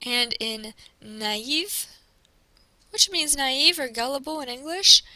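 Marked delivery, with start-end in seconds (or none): 1.0-1.9 "naïve" drawn out slowly, A then EE; both vowels sounded. Shown as a demonstration. Teacher demonstrating tréma to the class, both vowels sounded. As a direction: keep A and I apart in "naïve". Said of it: "you hear the A".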